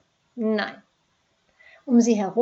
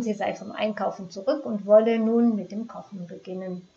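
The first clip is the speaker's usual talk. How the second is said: usual speaking voice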